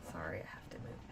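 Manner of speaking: quick whispered aside while shifting; whispered apology to the stream. Real, not acted. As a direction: drop to a breathy whisper for a moment. Apologizing mid-stream, moving position.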